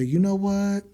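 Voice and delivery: high sarcastic shrug, it is what it is; mocking resignation. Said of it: "And I gotta say".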